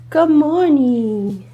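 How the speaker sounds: slow, high cooing greeting; cooing to a dog. Real, not acted. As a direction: coo gently to wake him. Waking sleeping dog.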